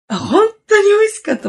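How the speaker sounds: high, excited burst of praise; gushing over the food, real delight. Real, not acted. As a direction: squeal how good it was. Raving about udon.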